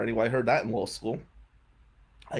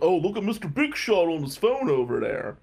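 higher-pitched mock jeer; mocking mechanic; 5 semitones higher than usual. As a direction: imitate a worker needling a customer. Mechanic heckles customer on phone.

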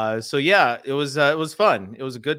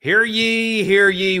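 loud, high proclamation call; town crier; 10 semitones higher, 9 dB louder, slower than usual. Acted. Podcast opening proclamation.

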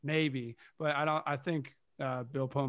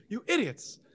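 acted scolding; 7 semitones higher, 3 dB louder than usual. Acting out captain scolding his men.

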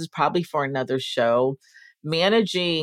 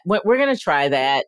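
normal talking voice

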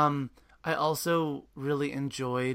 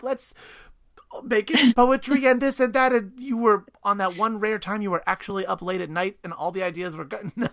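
excited; 8 semitones higher, 8 dB louder than usual. Pretend. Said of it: "Were you like".

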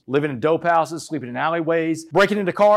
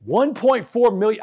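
"One point four million" slow, high and loud; incredulous emphasis; 6 semitones higher, 5 dB louder, slower than usual. Real, not acted. Bond amount repeated in disbelief.

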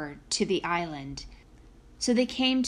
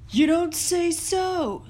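giant voice; 8 semitones higher, 4 dB louder, slower than usual. A narrator's reading. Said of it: "said the giant".

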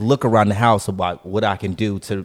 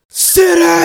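very high, loud drawn-out "Sit down!"; barked command; 9 dB louder than usual. Retold or imitated. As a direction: yell it like a furious order. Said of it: "Did he really drag it out".

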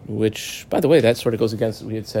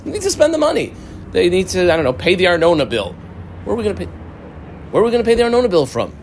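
higher, hurried worry over the Arnona bill; fretting guardian; 5 semitones higher, faster than usual. Acted. Orphans' guardian frets about paying the Arnona bill, higher and hurried.

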